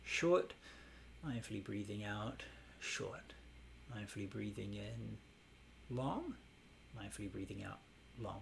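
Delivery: soft cue with short and long lifted at the end; meditation cue demo. Shown as a demonstration. Teacher demonstrating the breath cue to the class, softly. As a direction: guide the breath calmly, tag each length. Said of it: "I like to put the long or short kind of at the end".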